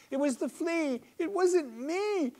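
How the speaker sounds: a tiny creature screeching for its life; helpless screeching. A narrator's reading. Bedbug pleading innocence from the maid's fingers, screeching. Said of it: "He screeches this out helplessly".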